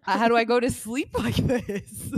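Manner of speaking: half-laughing retold dismay; bewildered protest.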